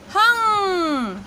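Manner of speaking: हंग stretched very slowly, nasal ending held; drawn-out syllable. Shown as a demonstration. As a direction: sound out हंग slowly for the class. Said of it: "उच्चारण हो जाएगा".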